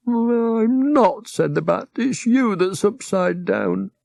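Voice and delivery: drowsy bat correcting them gently; a high, dreamy little bat; sleepy bat. Acted.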